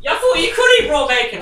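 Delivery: high indignant yell; shouting. Real, not acted. Shouted protest.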